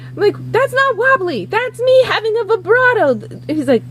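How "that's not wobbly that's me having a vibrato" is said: high-pitched offended correction; hammed-up singer's indignation; indignant protest. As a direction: defend yourself, mock-wounded and high.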